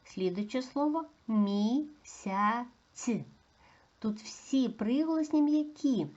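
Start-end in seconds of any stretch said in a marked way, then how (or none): 1.3-3.1 МІСЯЦЬ slowly split into syllables; slow, careful syllable demonstration; sounded out. Shown as a demonstration. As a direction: stretch each part of МІСЯЦЬ. Teacher sounds out word for analysis.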